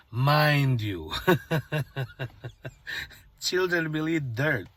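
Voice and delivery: normal talking voice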